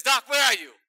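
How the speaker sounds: loud, high call to the doctor; hammed-up groping call. Fake blind patient, loud hammed-up call.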